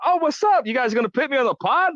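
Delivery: high, eager, hammed-up greeting; mock excited. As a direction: act thrilled to be on the show. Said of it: "I was like".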